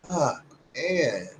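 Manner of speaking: normal talking voice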